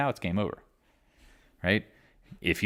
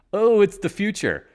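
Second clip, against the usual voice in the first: high, louder mocking buyer voice; mock bag holder; 11 semitones higher, 6 dB louder than usual. Mocking the buyers at the top, high and louder. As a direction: sneer it like a naive dip buyer.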